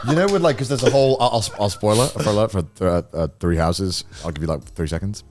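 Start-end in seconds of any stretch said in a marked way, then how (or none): none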